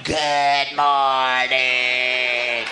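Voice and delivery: deep, slow, drawn-out demonic growl; a possessed wife croaking good morning. Retold or imitated. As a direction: stretch good morning into a deep monster groan. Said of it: "she's going".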